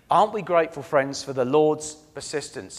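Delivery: ordinary delivery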